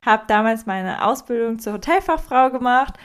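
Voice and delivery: ordinary delivery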